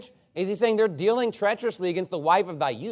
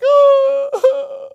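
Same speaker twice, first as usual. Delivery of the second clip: loud, high fake sob; exaggerated crocodile-tears wail; mock crying wail; 12 dB louder than usual. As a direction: burst into a phony bawl. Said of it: "crying on the bo".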